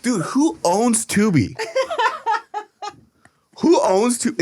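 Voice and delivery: high, slow, giggling "who owns Tubi"; mocking the streaming service, laughing; laughing question. Real, not acted. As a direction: ask it still cracking up. Laughing at clip.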